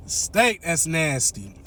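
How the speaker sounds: usual speaking voice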